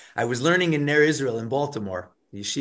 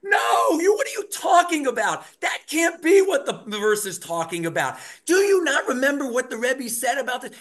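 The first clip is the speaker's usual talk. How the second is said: imitating students yelling over a verse; mock heated yelling; 9 semitones higher than usual. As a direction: shout it like a fierce study partner. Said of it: "they're screaming".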